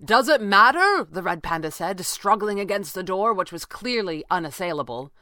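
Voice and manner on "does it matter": loud, raised retort; exasperated retort. A narrator's reading. Red Panda struggling against the door, exasperated retort. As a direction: throw the question back forcefully.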